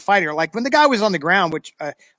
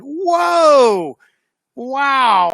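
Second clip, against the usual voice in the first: incredulous whoa; 8 semitones higher, 5 dB louder than usual. Retold or imitated. Reacting to the detective's insult.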